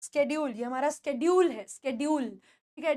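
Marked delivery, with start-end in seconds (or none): none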